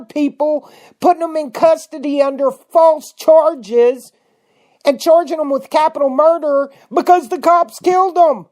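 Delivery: high, loud escalating outrage; rising fury, almost yelling at the listeners; angry. Real, not acted. Host raging at listeners over Waco arrests, loud furious rant. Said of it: "I'm showing some anger".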